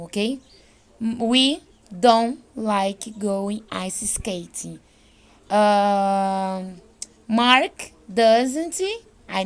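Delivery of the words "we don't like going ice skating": "don't" said as "dom" before "like", slowly; dropped T in don't. Shown as a demonstration. Teacher repeats the T-less don't for students, slow. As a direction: swallow the T of "don't". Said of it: "vira dom".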